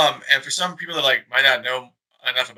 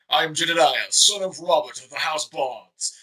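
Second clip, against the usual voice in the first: dark lord; 3 semitones higher, slower than usual. Pretend. Mock noble self-introduction.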